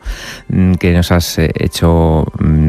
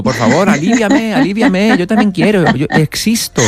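high pleading voice for the fascia; 11 semitones higher than usual. Retold or imitated. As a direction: beg high and needy. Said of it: "Pidiendo, casi, suplicando".

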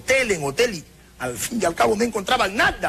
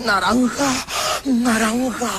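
aroused code word; 6 semitones higher, much slower than usual. Acted. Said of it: "Cuando tú te excites tú vas a decir".

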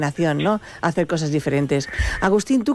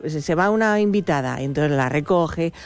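normal talking voice

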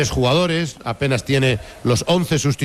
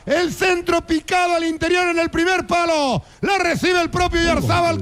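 very high, sustained football commentary shout; full-cry play-by-play. Real, not acted. Commentator reliving the shot off Gallese's post, high shouted play-by-play. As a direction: keep every phrase ringing high to its end. Recaps the chance at full pitch.

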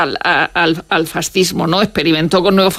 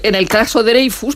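ordinary delivery

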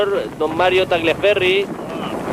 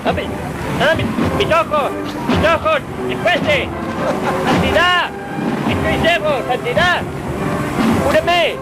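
high, whining pain; hammed-up pain; 9 semitones higher, slower than usual. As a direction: plead for a cure, hamming it. Sprayed reporter cries for eyes.

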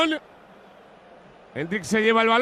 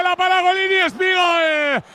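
goal call; 9 semitones higher, 4 dB louder than usual. Real, not acted. Goal celebration commentary.

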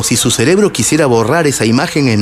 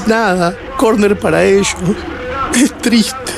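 high-pitched, tearful son's reply; hijo llorando; 8 semitones higher than usual. A narrator's reading. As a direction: answer your father in a high, upset voice. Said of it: "Y yo le contesté". (voz aguda de chico que llora)

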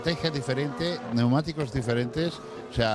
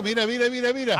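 excited; 10 semitones higher, 3 dB louder than usual. Real, not acted. Excited pointing at overtake.

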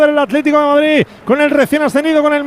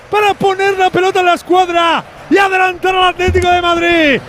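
goal commentary; 6 semitones higher than usual. Real, not acted. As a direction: belt out the goal story.

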